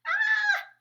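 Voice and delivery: piercing high scream; shriek. A narrator's reading. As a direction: shriek in terror. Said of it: "shrieked Flap".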